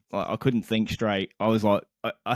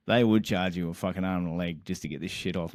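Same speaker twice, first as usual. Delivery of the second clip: ordinary talk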